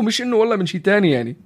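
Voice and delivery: ordinary delivery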